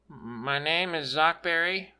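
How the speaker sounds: speaking up a bit. A narrator's reading. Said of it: "The kid spoke a little louder".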